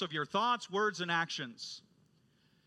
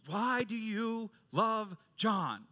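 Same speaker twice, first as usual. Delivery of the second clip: slow and higher, WHY drawn out; insistent question; 5 semitones higher, slower than usual. Retold or imitated. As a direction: press "Why do you love John" slowly, pausing before JOHN. Professor presses the same question again.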